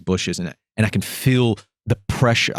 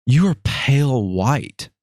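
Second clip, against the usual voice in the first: put-on shocked girlfriend; 6 semitones higher, slower than usual. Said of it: "she's like".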